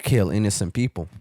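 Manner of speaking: ordinary delivery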